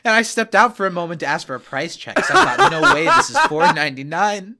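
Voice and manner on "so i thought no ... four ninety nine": high, loud laughing delight. Retold or imitated. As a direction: laugh through the bargain. Retold delighted thought.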